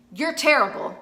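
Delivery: real mad. Retold or imitated. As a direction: say it like you mean it, mad. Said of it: "I would be like".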